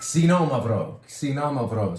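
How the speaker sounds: Xinomavro, starting high and loud, ksee-no-MAV-ro; introducing a Greek grape name, clearly; announcing the name. Shown as a demonstration. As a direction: present Xinomavro clearly, like a teacher. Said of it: "get to know one other grape name".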